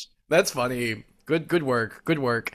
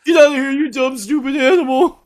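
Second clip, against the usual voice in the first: high, loud hammed-up yell; mock angry shout; 10 semitones higher, 7 dB louder than usual. Host reenacts driving Ghost away, high mock angry shout.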